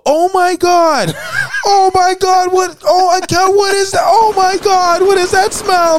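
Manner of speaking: high shrieking lady disgusted; disgusted by smell. Retold or imitated. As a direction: shriek like she smelled something foul. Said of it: "I just hear this lady go".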